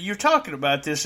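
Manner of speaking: usual speaking voice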